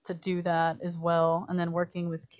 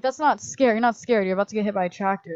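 raised, insistent director voice; urgent mock scolding; 3 semitones higher, 7 dB louder than usual. Director urging a four-year-old to act scared, insistent. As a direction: push the kid to look scared, fast.

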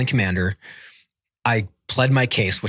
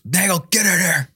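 higher-pitched mock sergeant's yell; imitated yell; 8 semitones higher than usual. Sergeant major summons his Marine, imitated yell. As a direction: summon someone sharply, imitating an angry superior. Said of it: "I'm getting yelled at".

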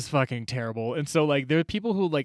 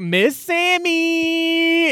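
high, drawn-out, sing-song "Miss Sammy"; insistent Miss Sammy; 7 dB louder, much slower than usual. Retold or imitated. Sammy correcting Aster on her name, drawn out high.

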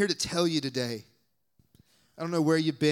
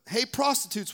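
higher mocking hail; mock accusing call; 8 semitones higher than usual. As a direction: call it out sarcastically. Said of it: "Doesn't say".

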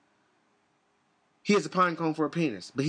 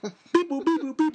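high, bouncing beep-boop gibberish; mock foreign caller; 8 semitones higher, slower than usual. Imitating a caller from China, high beep-boop gibberish. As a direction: pipe out nonsense beeps in rhythm.

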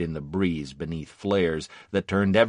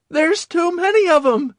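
loud, very high wail; panicked cry; 8 dB louder than usual. A narrator's reading. Wounded Victor overwhelmed by the enemy's numbers, crying out. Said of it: "he cried".

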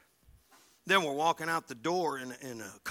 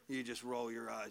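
usual speaking voice

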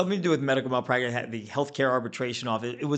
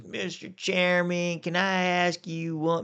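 slow, higher imitated Southern drawl; deep Southern accent; 5 semitones higher, much slower than usual. Committee chairman questioning a delegate, deep Southern accent. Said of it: "Deep Southern accent".

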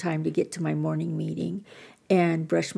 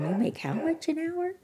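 much higher, astonished question; mock gasp at the wage; amazed disbelief; 11 semitones higher, 5 dB quieter than usual. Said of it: "everybody's going".

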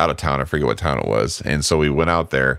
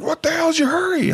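mock cop yell. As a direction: play the screaming cop as a squeaky joke. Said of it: "he's like".

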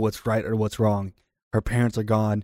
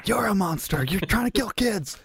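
high accusing retort; 11 semitones higher than usual. Acted. Accusing Victor of trying to kill kids, outraged high retort. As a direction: voice jumps up in outrage.

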